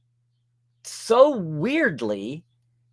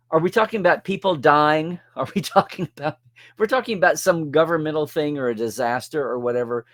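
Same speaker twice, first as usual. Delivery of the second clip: ordinary talk, nothing marked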